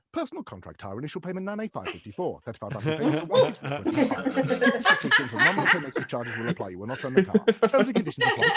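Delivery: fast, rattled-off disclaimer; fast legal disclaimer. Shown as a demonstration. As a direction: race through the small print. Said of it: "fast legal disclaimers".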